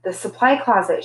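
usual speaking voice

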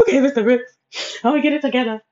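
sniffle out a promise; teary promise. Retold or imitated. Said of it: "I ended up crying some more".

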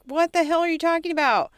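loud, high, disbelieving outburst; mock outrage at a crazy idea; incredulous. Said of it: "And I'm like".

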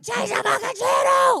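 high, loud, slowed shout at the TV; retold shout. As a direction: yell it like the ads make you cry. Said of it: "now I'm like".

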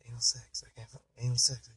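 frantic whisper to himself while flipping pages; whispered panic of a priest searching his book; panicked whisper. Acted. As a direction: hiss it under your breath, scared of being heard.

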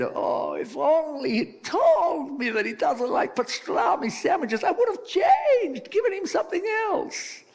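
very high, loud, hammed-up lament; shrieky mock grief, Italian style; wailing Italian wife. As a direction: wail like an Italian widow, over the top. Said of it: "the Italian's wife said".

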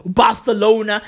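Barcelona with th for the c; lisped c. Retold or imitated. Imitating the lisping king, Barcelona said with a th. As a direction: lisp Barcelona as "Barthelona". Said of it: "He couldn't pronounce Barcelona properly".

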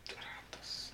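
hushed whispered echo; whispering. Pretend. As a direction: breathe the name in a whisper. Whispered echo of team name.

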